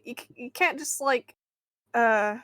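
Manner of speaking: ordinary talk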